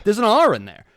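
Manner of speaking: loud, high-pitched retold objection; indignant protest. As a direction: act out correcting mom, exasperated and loud. Retold protest at mother's pronunciation.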